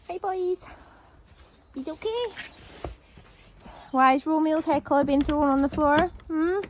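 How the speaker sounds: sing-song scold to pets; high pet talk. Real, not acted. Greeting and mock-scolding horses.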